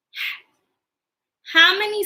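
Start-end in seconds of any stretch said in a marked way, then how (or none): 0.1-0.5 HAT in a hushed whisper. Shown as a demonstration. Teacher leading children through word parts, Hat whispered. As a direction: whisper Hat as one short beat. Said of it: "Whisper with me".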